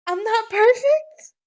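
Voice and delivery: very high, loud, slow wounded squeal; a little girl crushed, squealing the question up high; shocked child. Retold or imitated. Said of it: "Lola looks at her dad and goes".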